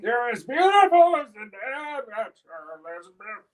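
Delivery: put-on high, loud old man voice. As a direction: croak it like a doting old man. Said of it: "I'm quite good at the old man".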